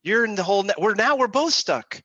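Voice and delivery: alarmed man in hole. Retold or imitated. Man in a hole yelping at his friend who jumped in. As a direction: yelp in dismay at the friend. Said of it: "looks at his friend and says".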